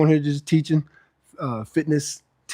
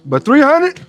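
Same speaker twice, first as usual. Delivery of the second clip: excited; 12 semitones higher, 8 dB louder, slower than usual. Real, not acted. Proud of reaching halfway mark.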